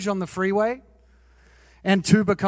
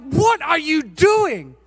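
higher, slow, disbelieving; mock wife; 8 semitones higher, 3 dB louder, much slower than usual. Fiancée to him after the finger wave, slow and disbelieving. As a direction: drag it out, shocked. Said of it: "wife-to-be looks at me and is like".

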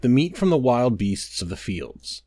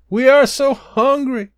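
crying out; 10 semitones higher, 5 dB louder than usual. A narrator's reading. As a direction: stretch it out like starving wanderers pleading. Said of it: "Again they cried".

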